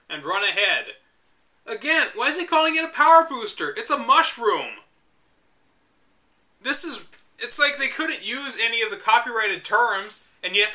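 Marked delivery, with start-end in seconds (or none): 1.7-8.8 incredulous rant. Real, not acted. Complaining about the guide's wording, incredulous rant. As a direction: demand an answer from the book. Ranting about "power booster.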